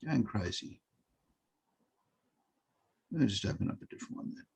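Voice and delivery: low, quiet mumble to oneself; muttering to self. Real, not acted. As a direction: think aloud softly while searching. Muttering to himself while searching files.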